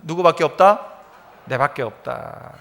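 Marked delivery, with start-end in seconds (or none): 1.5-2.5 low, soft and slow, speaking to oneself; quiet resolve. Acted. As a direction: murmur it as a private vow. Preacher voicing inner resolve. (낮고 조용한 혼잣말)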